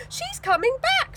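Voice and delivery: high, slow excited cry; thrilled she is coming; excitedly. Acted. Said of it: "said Tina excitedly".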